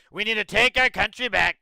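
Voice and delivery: a hint of a campaign-speech Trump; mock Trump rally line. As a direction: parody a politician's rallying cry. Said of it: "the whole Trump thing".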